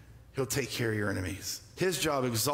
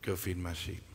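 Jesus' tender commission; 5 semitones lower, 4 dB quieter, faster than usual. Retold or imitated. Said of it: "set him on mission and said".

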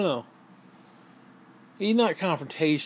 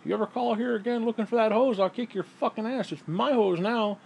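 mock threat over a hose; 7 semitones higher than usual. Threatening the ex-husband over the hose, mock macho. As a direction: growl it over the hose, half joking. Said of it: "he's like".

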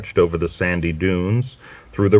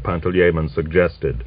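ordinary delivery

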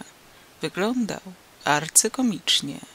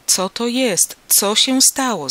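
higher and louder, slow alarmed outcry; alarmed call; 5 semitones higher, 7 dB louder, slower than usual. A narrator's reading. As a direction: call out in sudden fear, voice no longer held back. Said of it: "zawołał, już nie hamując głosu". (zaniepokojony, głośny okrzyk)

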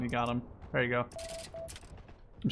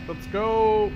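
louder, higher rallying call; hyped up; 8 semitones higher, 6 dB louder than usual. Real, not acted. Gamer rallying his squadmate to move, hyped call. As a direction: pump up your teammate.

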